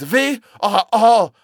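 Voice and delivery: loud, high, drawn-out terror; shrieking. A narrator's reading. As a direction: shriek slowly in utter horror. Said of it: "he shrieked".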